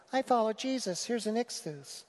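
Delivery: high, soft mock-pious voice; small, high voice poking light fun; gently mocking. Preacher imitating a believer with a bumper sticker, mocking. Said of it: "I'm not mocking you".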